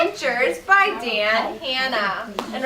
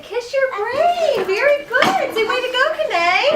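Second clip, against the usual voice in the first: much higher and louder cheering praise; high, loud classroom cheer; excited praise; 12 semitones higher, 7 dB louder than usual. Real, not acted. Teacher praising a pupil.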